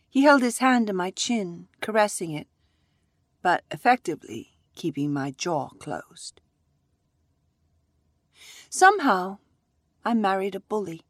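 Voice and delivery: plain reading voice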